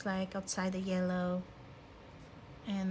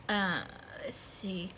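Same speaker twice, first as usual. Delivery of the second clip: slow, voiceless "let's see" while thinking; a slow whispered "let's see" while checking the page; whispered aside; 3 semitones lower, 4 dB quieter, slower than usual. Real, not acted. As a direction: breathe it out to yourself, unvoiced. Musing to herself while looking.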